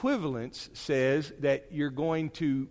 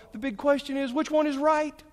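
mock eager question; 13 semitones higher, 6 dB louder than usual. Shown as a demonstration. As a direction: ask it high and loud, all worked up. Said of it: "That's not the question".

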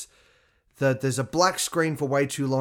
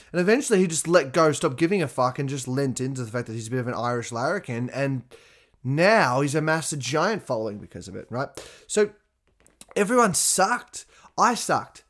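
usual speaking voice

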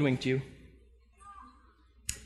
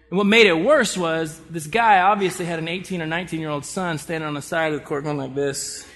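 normal talking voice

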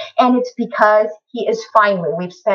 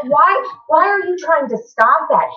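higher, slow, baffled protest; incredulous why; 6 semitones higher than usual. Retold or imitated. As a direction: ask it like it makes no sense. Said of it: "I'm like".

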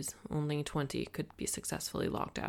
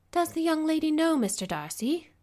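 much higher, louder, rising housekeeper voice; curious housekeeper; 12 semitones higher, 6 dB louder than usual. A narrator's reading. Housekeeper asking the Gardiners, surprised and curious. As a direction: ask it with raised, curious surprise. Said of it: "Missus Reynolds asked the Gardiners".

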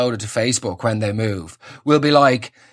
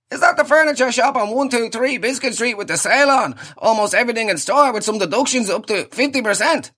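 hammed-up sales pitch, much higher; cheesy radio ad voice; 7 semitones higher than usual. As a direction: sell the sale like a fake friend. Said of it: "You know the ones".

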